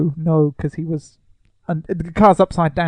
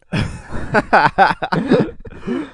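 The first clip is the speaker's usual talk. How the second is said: high, drawn-out laugh; 4 semitones higher than usual. Real, not acted. Laughing at their own grim ending for the film, helpless laugh. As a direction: burst out laughing for a couple of seconds.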